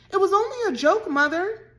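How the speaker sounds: high, loud, quick and trembling; quivering voice. A narrator's reading. Caddy pleading with her mother, quivering. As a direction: plead with a shaking voice. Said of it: "she said in a quivering voice".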